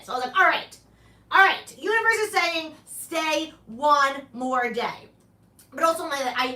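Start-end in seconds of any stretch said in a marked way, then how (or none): 0.4-5.1 excited decision. Retold or imitated. Deciding after the coin flip.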